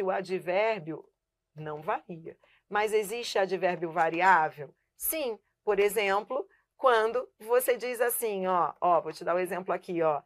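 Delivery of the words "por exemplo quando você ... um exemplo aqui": ordinary delivery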